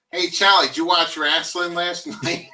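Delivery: put-on loud, high Philly accent; dad's Philly accent. Philly guy asking his pal about wrestling, Philly accent. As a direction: holler it slowly in Philly talk. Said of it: "they say".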